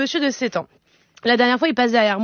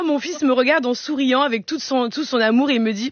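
ordinary talk, nothing marked

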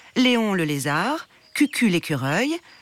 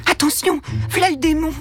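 higher, urgent servant voice; hushed servant warning; 10 semitones higher, 3 dB louder than usual. A narrator's reading. Servant warning others as Ange passes, higher and urgent. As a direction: warn of the little devil. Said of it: "chuchotaient les domestiques".

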